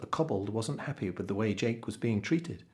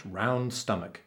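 plain reading voice

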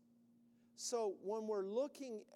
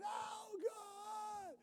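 much higher panicked plea; mock desperate cry; 13 semitones higher than usual. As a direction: wail to heaven in distress.

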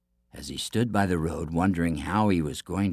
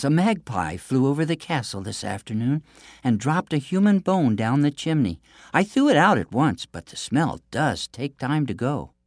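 higher-pitched, lightly voiced princess; princess character voice; 6 semitones higher, 4 dB louder than usual. A narrator's reading. Princess makes excuses to the giant, lightly voiced. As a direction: explain it away in a lifted princess voice.

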